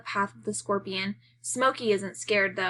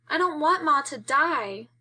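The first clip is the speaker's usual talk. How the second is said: put-on higher, childish sob-voice; crying; 6 semitones higher than usual. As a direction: cry it like a scared little boy, higher. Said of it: "Buddy cries".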